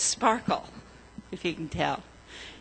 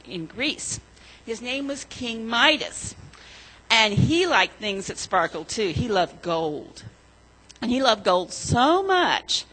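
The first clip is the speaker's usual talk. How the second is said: put-on storytelling to children; 5 dB louder than usual. A narrator's reading. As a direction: tell it like a children's story, leaning on each gold. Children's story told in church.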